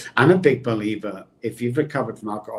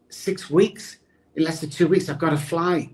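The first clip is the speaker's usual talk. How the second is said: panicked disbelief; 7 semitones higher than usual. Retold or imitated. Begging the passport clerk, panicking about the flight. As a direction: push back desperately, voice up high. Panicked at six-week wait.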